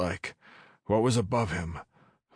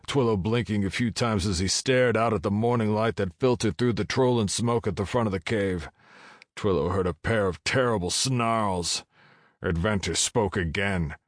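ordinary delivery, nothing marked

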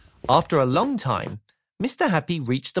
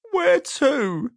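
high, squeaky question; sad squeaky voice; 12 semitones higher than usual. Acted. Mister Miserable asks where, squeaky and doubtful. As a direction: ask doubtfully. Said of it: "a sad, squeaky sort of voice".

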